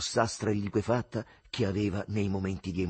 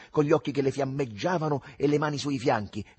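usual speaking voice

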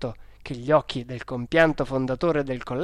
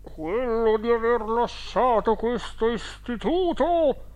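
high, drawn-out spectral reproach; angry ghost; 8 semitones higher, slower than usual. A narrator's reading. Hidden boy accusing the directors as their dead uncle, raised angry ghost voice. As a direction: intone the accusation like an offended spirit. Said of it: "con voce un po' più alta e con accento adirato".

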